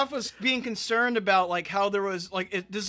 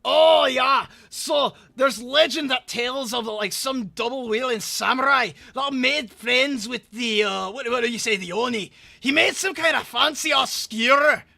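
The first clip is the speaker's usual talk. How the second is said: excited character voice; 5 semitones higher, 8 dB louder than usual. Retold or imitated. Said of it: "'cause she's like".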